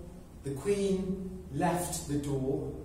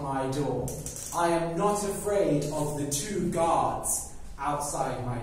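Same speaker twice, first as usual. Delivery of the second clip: loud boasting; 2 semitones higher than usual. Acted. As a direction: shout your brags at the guards outside. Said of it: "He said very, very loudly".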